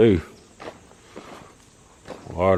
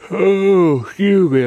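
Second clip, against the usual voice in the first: talking through a yawn; stretched out mid-yawn; 10 semitones higher, 5 dB louder, slower than usual. Real, not acted. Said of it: "I'm yawning".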